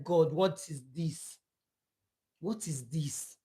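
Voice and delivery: low, hushed and broken, asking God what this is; hushed, tearful plea; tearful cry to God. Retold or imitated. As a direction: murmur it as if weeping again. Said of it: "I was weeping".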